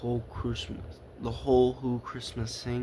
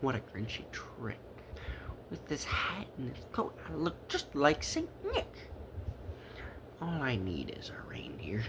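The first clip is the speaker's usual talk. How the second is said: soft, gleeful scheming; a hint of a chuckling villain; lightly voiced chuckling Grinch; 8 dB quieter than usual. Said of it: "cackled and chuckled".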